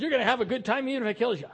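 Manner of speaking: fast, pushy mock cheerfulness; mock enforced fun; forced cheer. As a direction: rattle it off like a bossy host. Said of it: "it's that attitude".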